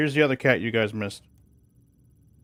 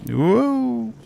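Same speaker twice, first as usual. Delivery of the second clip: surprised whoa; 12 semitones higher than usual. Real, not acted. Ship nearly overheating.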